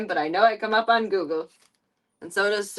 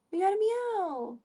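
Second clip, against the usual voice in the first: cooing to cat; 11 semitones higher, 4 dB quieter, slower than usual. Acted.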